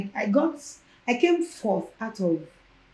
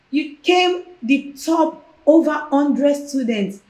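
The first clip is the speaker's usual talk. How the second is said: louder, slower and higher, proud news; excited announcement; 6 semitones higher, 6 dB louder, slower than usual. Retold or imitated. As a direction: announce it like big news to her. Lecturer announcing her top result.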